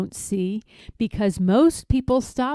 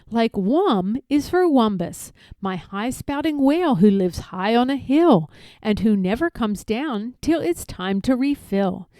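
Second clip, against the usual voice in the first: ordinary delivery, nothing marked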